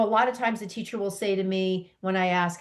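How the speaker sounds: ordinary delivery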